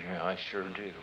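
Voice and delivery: very soft, low muttered answer; quiet inner reply. Retold or imitated. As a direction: agree under your breath.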